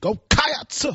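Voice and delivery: "Cats" cried out high and stretched; wailing Go Cats like a preacher; preacher-like cheer. Retold or imitated.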